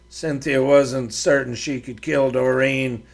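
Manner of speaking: usual speaking voice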